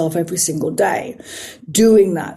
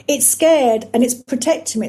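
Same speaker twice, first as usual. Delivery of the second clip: normal talking voice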